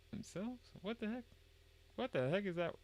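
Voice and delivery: very high, soft incredulous voice; baffled squeak. Pretend. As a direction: puzzle over it in falsetto. Baffled by a garbled line.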